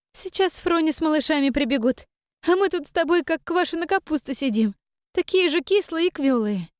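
put-on high, lively young woman; young daughter voice.

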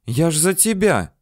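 object, a touch offended; lightly indignant. A narrator's reading. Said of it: "возмутилась она".

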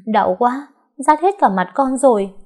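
milk the pain; whining moan. Acted. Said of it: "đệm thêm vài tiếng rên".

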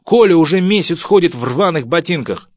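high, loud reproachful yell; angry shout. Acted. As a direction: shout the accusation at the husband. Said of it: "закричала жена". (крик с упрёком)

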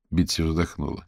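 usual speaking voice

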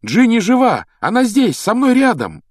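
high, loud calling; excited shout. Acted. Boy telling friend his sister is safe, shouting excitedly. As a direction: shout the good news to someone out of sight. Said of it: "крикнул Гарри".

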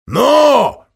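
sharp high impatient yell; impatient shout. Acted. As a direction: demand an answer now. Impatient captain calling.

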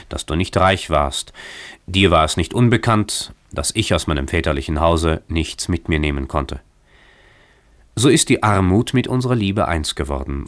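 ordinary delivery, nothing marked

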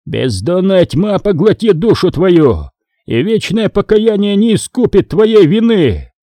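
high, slow declaimed damnation; growled curse. A narrator's reading. Priest damning traitors as he strikes, growled righteous curse. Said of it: "прорычал очиститель".

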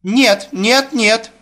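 high-pitched panicky protest; frantic refusal. Acted. Daughter rejecting the suitor before her father, high and frantic. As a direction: refuse over and over, pitched right up.

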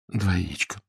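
«Два яичка» echoed softly and meekly; meek repetition. A narrator's reading. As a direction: repeat it quietly, defeated. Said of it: "повторил Фредерик". (покорно и тихо повторяет)

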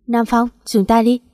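ordinary delivery

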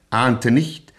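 ordinary talk, nothing marked

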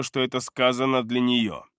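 plain reading voice

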